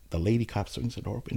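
usual speaking voice

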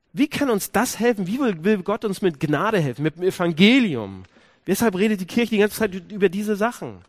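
urgent pulpit build; full-force preaching. Acted. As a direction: preach it, pressing the congregation. Preaching climax, rhetorical questions.

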